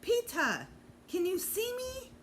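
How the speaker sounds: put-on high, eager child calling. A narrator's reading. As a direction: sing out the name to the crowd. Fudge still calling from stage.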